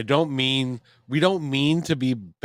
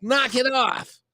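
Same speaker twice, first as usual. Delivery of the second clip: raised, high-pitched outburst; indignant rebuke; 11 semitones higher, 4 dB louder than usual. Retold or imitated. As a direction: tell mother off, acted exasperation. Acting out argument with mother.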